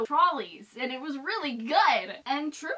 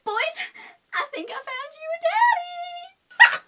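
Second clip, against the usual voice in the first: swooning squeal; 7 dB louder than usual. Pretend. Swooning joke.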